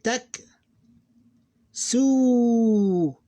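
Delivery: "Tak" short, then "su" held long, high and loud; teacher reading the next word to the class; drawn-out Arabic syllables. Shown as a demonstration. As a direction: clip "Tak", stretch "su". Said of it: "All right, here we go".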